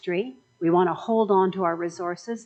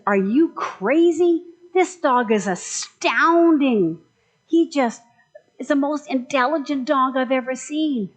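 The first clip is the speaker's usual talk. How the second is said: astonished butcher; 4 semitones higher, 4 dB louder than usual. Acted. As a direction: gush in disbelief about the dog. Butcher protests to dog owner.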